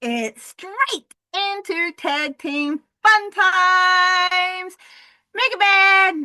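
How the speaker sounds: high, loud slowed announcing; hype announcer. Acted. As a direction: stretch it out like a ring announcer. Hyped match announcing.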